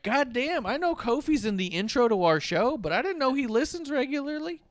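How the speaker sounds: high excited, laughing through it; giddy disbelief. Retold or imitated. Host thrilled that Kofi listens, high and laughing. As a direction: gush like a starstruck fan. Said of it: "I was like".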